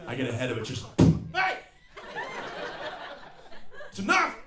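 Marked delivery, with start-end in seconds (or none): 1.3-4.3 high, sudden dad shout; short mock-furious bellow; acted angry yell. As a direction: yell into the house like a fed-up dad. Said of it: "I yell into the house".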